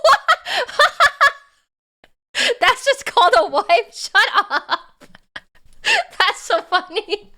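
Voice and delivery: high giggling through words; laughing. Real, not acted. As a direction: laugh while talking. Laughing at wife joke.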